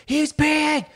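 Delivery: very high-pitched frantic outcry; imitated frantic woman. Woman behind the host alerting everyone to the peeing dog, imitated frantic. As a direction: mimic a woman losing her mind. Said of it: "losing her fucking mind".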